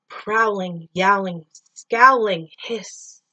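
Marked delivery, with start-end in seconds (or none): none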